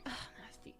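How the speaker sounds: whispered disgusted aside; hushed mock disgust. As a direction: reenact recoiling, barely audible. Retold disgust at the vomit.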